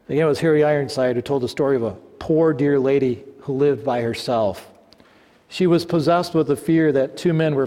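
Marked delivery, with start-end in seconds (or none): none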